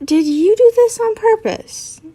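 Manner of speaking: high-pitched, hysterical question. Acted. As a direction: ask it in a high, panicky voice. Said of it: "Draco asked, uh, hysterically".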